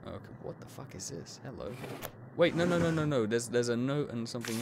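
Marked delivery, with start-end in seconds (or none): 0.1-1.7 breathy tense whisper. Real, not acted. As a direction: whisper it under your breath. Tense discovery in dark room.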